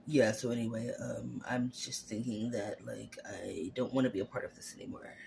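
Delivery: low, cold, dead monotone fading to a whisper; dead fish voice. Retold or imitated. Fiance dumping her by phone, low cold deadpan. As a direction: sound completely checked out and cold. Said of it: "like a dead fish sound".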